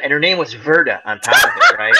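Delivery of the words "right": ordinary talk, nothing marked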